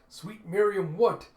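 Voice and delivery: high, loud burst of disbelief; shocked exclamation. Acted. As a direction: cry out in alarmed astonishment. Acted shocked reaction.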